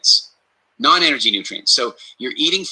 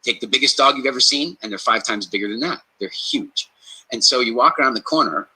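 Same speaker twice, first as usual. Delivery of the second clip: ordinary talk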